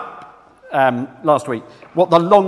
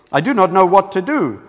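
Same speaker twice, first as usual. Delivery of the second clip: hammed-up Italian accent, emphatic; bad Italian accent; 3 semitones higher, 3 dB louder than usual. Preacher quoting an opera singer's interview, bad Italian accent. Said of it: "sorry, bad accent".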